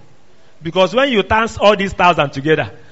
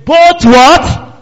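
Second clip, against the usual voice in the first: preaching call; 8 semitones higher, 8 dB louder than usual. Real, not acted. Preacher quizzes congregation on verse.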